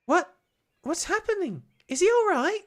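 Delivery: high-pitched anxious questions; worried. Acted. Child asking about collapsed Oliver, worried. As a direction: ask in rising alarm.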